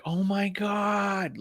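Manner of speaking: slow, high, breathless awe; overwhelmed. Retold or imitated. Fan receiving a gift from her hero, slow high awe. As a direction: let it drag out, stunned. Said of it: "And she was like".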